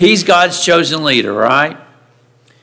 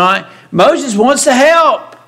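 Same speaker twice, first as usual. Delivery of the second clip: usual speaking voice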